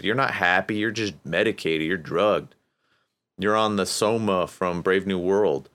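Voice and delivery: ordinary talk, nothing marked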